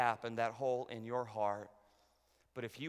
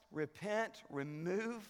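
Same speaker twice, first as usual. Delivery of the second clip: ordinary delivery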